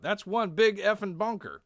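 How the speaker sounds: high, loud character voice; major's exclamation. Acted. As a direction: belt it out like an impressed army major. Major Dice's acted exclamation.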